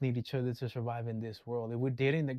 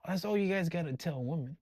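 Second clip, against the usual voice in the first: normal talking voice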